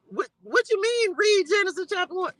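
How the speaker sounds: high-pitched baffled question; incredulous reaction. Retold or imitated. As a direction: question it back in acted-out disbelief. Acts out her startled question back.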